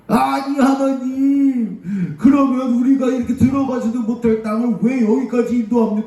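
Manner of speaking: high, acted wail of protest; sorrowful complaint. Israelites complaining to God after the spies' report, high mock wailing. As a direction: lament in a sustained high voice. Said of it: "슬퍼한 거예요".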